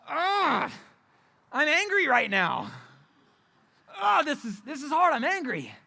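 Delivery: mock venting alone in the bedroom; reenacted frustration. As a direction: let the pent-up frustration out. Reenacts himself fuming in bedroom.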